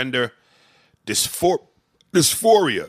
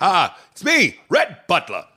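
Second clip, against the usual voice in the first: put-on louder, higher old-time radio voice; 1940s radio announcer; 7 semitones higher, 5 dB louder than usual. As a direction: introduce yourself like a 1940s radio host. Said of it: "his name in the movie was Rhett Butler".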